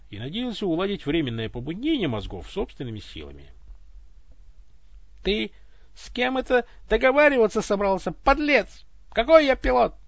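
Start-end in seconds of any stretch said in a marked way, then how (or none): 5.2-9.9 much louder, much higher furious voice; indignant outrage. Acted. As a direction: scold the insolent pilot. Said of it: "возмутился голос". (голос возмущённо кричит на пилота)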